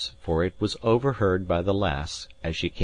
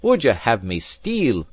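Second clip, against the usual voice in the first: angry retort; 5 semitones higher, 6 dB louder than usual. A narrator's reading. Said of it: "same appearance of fury".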